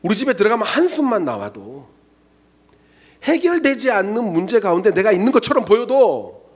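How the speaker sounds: ordinary talk, nothing marked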